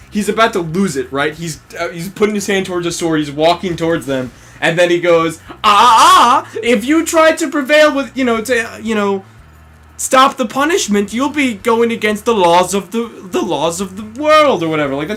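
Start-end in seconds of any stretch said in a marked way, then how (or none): 5.5-14.6 higher, louder, sneering taunt; higher, louder mock-haughty villain lecture. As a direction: wag a finger at him like a gloating bully.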